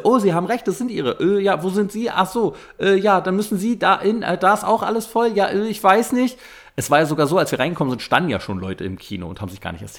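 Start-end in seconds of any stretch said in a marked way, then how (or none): 0.0-6.4 mock flustered usher. Voicing the confused usher.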